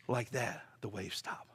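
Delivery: whispered, drawn-out "Like that". Acted. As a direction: hush it like the storm just died. Storm stops suddenly.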